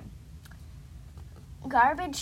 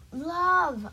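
higher, louder spoken word; repeated aloud; 5 semitones higher, 6 dB louder than usual. Acted. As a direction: call the word out in full voice. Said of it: "I said again".

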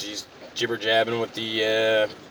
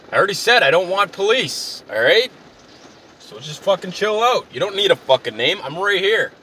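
high, worked-up rant; acted tirade, pitched high and forceful; irate customer; 7 semitones higher than usual. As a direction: berate the clerk in mounting exasperation. Acted quarrel over giving a name.

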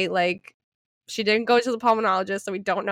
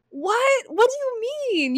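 incredulous; 13 semitones higher, slower than usual. Retold or imitated. Reacting to mom's claim.

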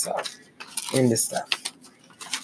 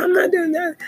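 protesting innocence. Retold or imitated. Pastor protesting to the church lady, very high. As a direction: squeak out an injured denial.

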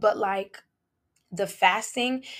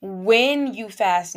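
"When" hit louder and drawn out, slow; stress on when; 6 dB louder, much slower than usual. Shown as a demonstration. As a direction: lean hard on "When" as a command. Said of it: "not if you fast".

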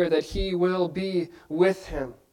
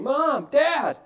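higher frightened call for mom and dad; child calling in fear; 8 semitones higher than usual. Retold or imitated. Child waking at night calling parents, scared high call. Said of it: "you hear your child screaming".

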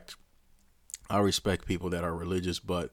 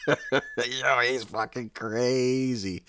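laughing; 6 semitones higher, much slower than usual. Real, not acted. Cracking up at Trump story.

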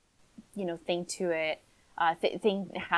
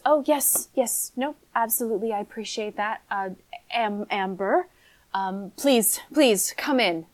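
relieved lady; 2 semitones higher, 4 dB louder than usual. Acted. Mother thanking the returning helpers, mildly flustered.

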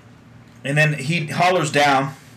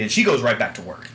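usual speaking voice

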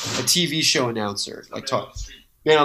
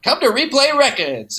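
high, silly ad pitch; TV commercial voice; 11 semitones higher than usual. Retold or imitated. Imitating their local record store ad, high silly voice. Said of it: "local T V would have a Street Side".

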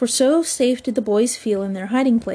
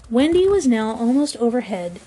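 ordinary delivery

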